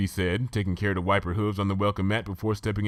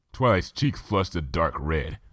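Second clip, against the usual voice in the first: ordinary delivery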